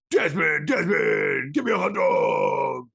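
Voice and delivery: slow hyped macho ad read; monster truck ad. Acted. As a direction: bellow it like a rally commercial. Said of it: "talk like monster truck rally commercials".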